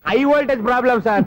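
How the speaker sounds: emphatic high-pitched answer; too loud. Acted. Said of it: "ఆ మాట మెల్లగా కూడా చెప్పొచ్చయ్యా".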